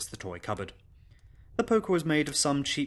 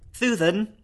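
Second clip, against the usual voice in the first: put-on high, loud childish call with a lisp; lisping little girl; 10 semitones higher, 7 dB louder than usual. Frightened little girl calls her governess at night, lisping. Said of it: "that exaggerated lisping".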